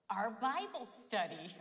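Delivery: slow, loud, amused reveal; laughing punchline. Retold or imitated. Wife tells husband where she got the idea, laughing. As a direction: stretch it out and break into a laugh.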